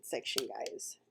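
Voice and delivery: breathy whispered aside. Real, not acted. Telling viewers a friend is watching, whispered. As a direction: hush it to the viewers.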